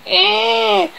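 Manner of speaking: high sustained squeal. Acted. Reader voices the sound effect, high squeal. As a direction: squeal high and thin on one note. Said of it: "great squealing".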